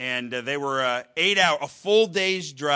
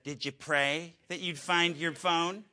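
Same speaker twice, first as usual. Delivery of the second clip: slow, slightly higher questioning wife; wife's voice; 4 semitones higher than usual. Retold or imitated. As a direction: ask it like a patient wife. Wife asks about the lost phone.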